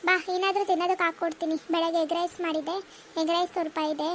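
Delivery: change the subject, offer food quickly; mother. Acted. Mother voiced in skit. (ವಿಷಯ ಬದಲಿಸುವ ಅಮ್ಮ)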